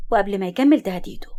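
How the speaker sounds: usual speaking voice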